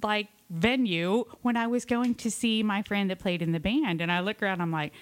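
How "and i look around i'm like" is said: ordinary talk, nothing marked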